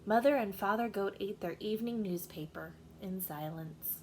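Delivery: usual speaking voice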